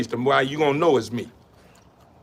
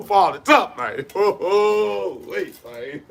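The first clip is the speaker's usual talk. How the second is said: laughing; slower than usual. Real, not acted. Cracking up about father.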